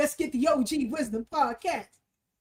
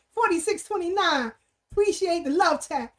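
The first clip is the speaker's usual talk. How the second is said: usual speaking voice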